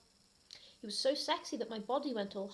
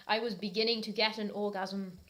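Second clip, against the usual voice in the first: ordinary talk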